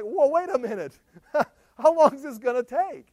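high, loud mock alarm; startled guest. Visitor startled at the slaughter, high mock alarm. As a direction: pull up short, amused and impatient.

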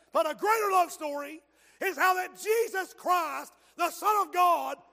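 rising to a loud preacher's shout; fervent pulpit shout; preaching at full force. Acted. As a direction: build the sermon to a cry.

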